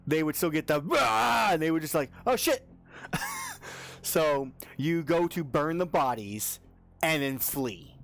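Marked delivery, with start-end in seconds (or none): 0.8-1.5 high loud playful roar; mock dragon roar. 2.3-2.7 high loud startled cry; startled yelp. Acted. GM voices startled foes. 2.9-4.0 squealing high laugh. Real, not acted. Laughs after the bit.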